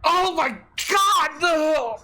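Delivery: high, slow agonized cry; anguished cry. Acted. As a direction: cry out in sudden agony. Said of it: "the man just is like".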